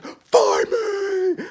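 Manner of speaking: very high, drawn-out imitated cry; mock desperate call. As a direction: wail it out as a hammed-up call. Acting out a character calling May.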